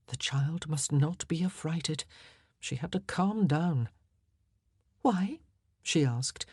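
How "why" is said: light, higher one-word question; mildly casual, covering worry; feigned nonchalance. Acted. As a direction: ask as if it hardly matters. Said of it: "trying not to sound as if it mattered much".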